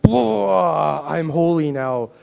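exaggerated eruption of sudden holiness; mock holy outburst. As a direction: blurt it big, like a hammed-up miracle moment.

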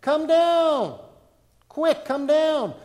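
high, loud, slow call; urgent call. Acted. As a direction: beckon him down urgently. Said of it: "he said".

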